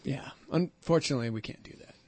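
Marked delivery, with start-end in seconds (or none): 1.3-1.9 whispered aside; whispering. Real, not acted. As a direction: drop to a breathy whisper. Aside about doing Bee Gees hour.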